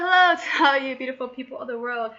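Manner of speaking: greeting in a voice warped by a burp; odd burp voice. Real, not acted. Vlogger greeting her viewers, voice warped by a burp. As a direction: say hello while a burp bends your voice. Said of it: "Don't know what that accent was".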